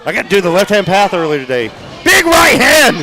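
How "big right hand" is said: high, slow, gravelly hype call; Matthias Dark impression. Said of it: "my Matthias Dark throwing impression".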